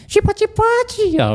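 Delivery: very high, alarmed squeal, playfully retold; mock panic. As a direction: imitate a startled woman demanding who's there. Frightened woman calling out.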